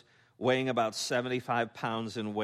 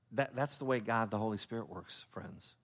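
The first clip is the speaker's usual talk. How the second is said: hushed; 4 semitones lower, 7 dB quieter than usual. Real, not acted. Preacher softly driving home the Holy Spirit lesson. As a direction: drop the voice low and soft for the point. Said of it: "preacher drops to hushed point".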